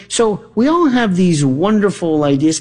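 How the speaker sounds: usual speaking voice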